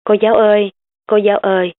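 put-on little girl calling through the empty room. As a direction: call out for the teacher. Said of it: "gọi vang".

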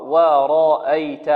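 ورأيت with a light hamza and a heavy ra; slow light hamza. Shown as a demonstration. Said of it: "الهمزة هنا مرققة". (همزة مرققة في ورأيت)

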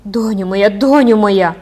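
a mother crying out in heavenly joy; joyful cry. A narrator's reading. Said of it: "вигукнула".

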